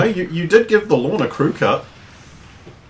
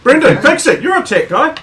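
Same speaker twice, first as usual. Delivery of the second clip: high loud jokey demand; shouting; 12 semitones higher, 5 dB louder than usual. Real, not acted. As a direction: yell the order at him.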